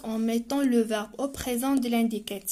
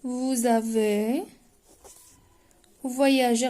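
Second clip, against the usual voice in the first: drawn-out, very slow "vous avez"; stretched for pupils to write; slow dictation; much slower than usual. Shown as a demonstration. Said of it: "Alors غنكتبو".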